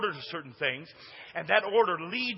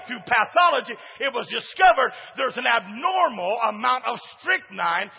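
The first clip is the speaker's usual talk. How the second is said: loud, punchy storytelling from the pulpit; preaching at full force; 5 semitones higher, 8 dB louder than usual. Acted. Pastor telling the church about Uncle Fuddy, loud preaching. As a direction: shout the tale to keep the room with you.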